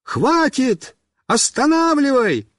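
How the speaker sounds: high-pitched call across a yard; urgent high holler; shouting. A narrator's reading. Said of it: "и крикнул".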